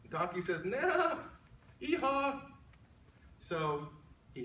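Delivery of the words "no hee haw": much higher braying no; donkey's refusal. Acted. Donkey refusing worm, high mock bray.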